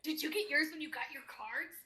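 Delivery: high, eager in-character questions; thrilled, high-pitched chatter; excited gushing. Acted. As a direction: pepper a new friend with delighted questions.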